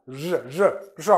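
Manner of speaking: "je" repeated as an isolated sound, soft; sound demo. Shown as a demonstration. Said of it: "C'est je". ("je" répété seul, doucement, comme un son isolé)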